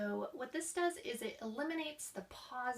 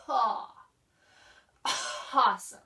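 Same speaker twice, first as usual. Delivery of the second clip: cough into speech; 10 dB louder than usual. Shown as a demonstration. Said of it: "from that cough, we are then going into our speech".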